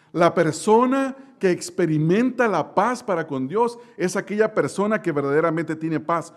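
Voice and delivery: ordinary delivery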